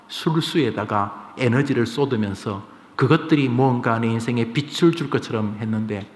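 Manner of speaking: ordinary talk, nothing marked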